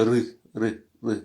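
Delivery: "Ri" with a rolled r and a throaty i, repeated; rolled r drill. Shown as a demonstration. Teacher drilling the class on the syllable, rolled r demo. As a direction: drill the syllable "ri", rolling the r and keeping the i back in the throat. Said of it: "Le R se roule et le I, c'est guttural".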